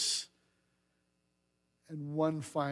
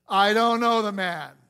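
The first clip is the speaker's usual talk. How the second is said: loud denial; 4 semitones higher, 10 dB louder than usual. Acted. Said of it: "to swear".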